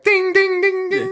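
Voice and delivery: ding the bell like a goofy mummy; high imitated ding ding ding ding; mock mummy ding. Mummy ringing the hospital bell.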